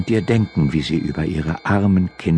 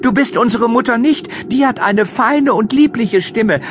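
put-on much higher, louder, defiant children's voice; defiant goat kids; 4 dB louder than usual. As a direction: kids calling out boldly through the door. (hohe, trotzige Kinderstimme)

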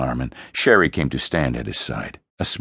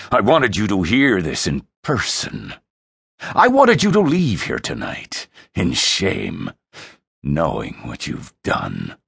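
scornful, accusing father; contemptuous dressing-down; disgust; 6 semitones higher, 4 dB louder than usual. Acted. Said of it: "Frey's voice dripped disgust".

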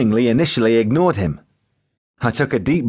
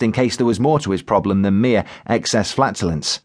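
ordinary delivery

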